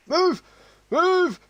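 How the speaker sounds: high, loud yelled command; shouting. Real, not acted. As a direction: yell it at the screen. Urging the character to hurry.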